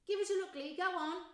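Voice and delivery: put-on high, loud pushy boy; eager kid. Boy in crowd urging Lee, high and eager. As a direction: beg for a look.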